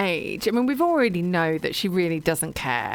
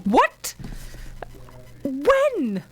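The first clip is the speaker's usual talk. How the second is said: retold shock; 8 semitones higher than usual. Reader re-enacting learning of a death, shocked disbelief. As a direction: act out disbelief, each word pitched high.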